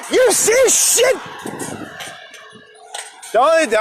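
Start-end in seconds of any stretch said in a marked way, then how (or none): 0.1-1.2 high, slowed shout of disbelief; disbelieving shout. Real, not acted. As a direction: yell it at the flooded street, stunned. Showing off his flooded street.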